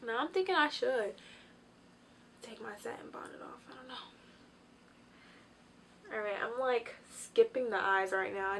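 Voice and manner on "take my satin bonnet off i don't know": whispered thought; half-whispered musing. Real, not acted. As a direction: whisper it to yourself. Muttering to self.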